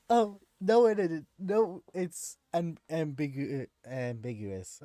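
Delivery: mocking high whine, dragging out the excuse; mocking high whiny voice. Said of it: "the bull crap of".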